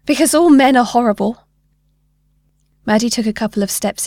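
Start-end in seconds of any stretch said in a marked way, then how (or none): 0.1-1.5 loud, higher cry of an upset mother; upset outburst. A narrator's reading. Crying mother answers her daughter, upset outburst. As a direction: blurt it out, upset and louder.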